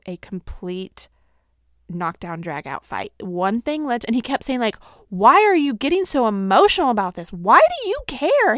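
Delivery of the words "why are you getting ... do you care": loud, high, fast bafflement; confused husband. Retold or imitated. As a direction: imitate an exasperated husband demanding to know why. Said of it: "he was so confused".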